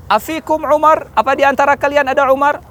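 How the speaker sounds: high, rising questioning call. Acted. Abu Sufyan to Muslims on the mountain, high challenging call. Commander calls up to hidden enemy.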